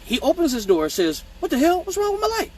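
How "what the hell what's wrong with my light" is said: high-pitched imitation of bafflement; mock bewildered complaint. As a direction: squeak out the indignant question. Acted repeat of baffled complaint.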